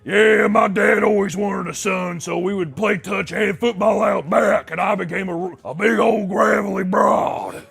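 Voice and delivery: loud, slow, gravelly broad voice; hammed-up gravelly mechanic woman; tomboy mechanic. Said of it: "the tomboy kind of mechanic who's just like".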